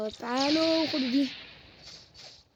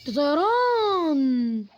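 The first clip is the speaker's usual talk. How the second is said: very slow, loud, drawn-out "طيران"; awed realisation; 3 semitones higher, 5 dB louder, much slower than usual. Real, not acted. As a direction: stretch it in wonder. Amazed discovering flight.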